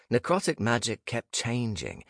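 ordinary delivery, nothing marked

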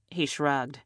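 ordinary delivery

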